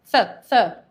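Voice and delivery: th th, two short puffs of air through the teeth; th sound. Shown as a demonstration. Teacher drills the th sound twice for students. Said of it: "T H tem som de F".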